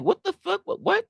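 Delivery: high-pitched squeak of disbelief; high startled what the fuck; shocked squeal. Real, not acted. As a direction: jump way up in pitch, stunned. Reacting to chat message.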